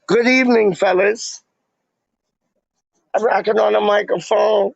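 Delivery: high, punchy showman hello to the chat; overzealous host greeting. Pretend.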